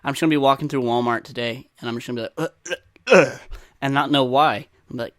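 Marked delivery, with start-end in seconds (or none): 2.4-3.4 mock straining grunts. Imagined accident in Walmart.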